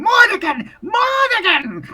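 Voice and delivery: high, loud croaking creature call; were-raven screeching its master's name. Acted. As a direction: shriek the name like a monstrous raven. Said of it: "you hear it calling".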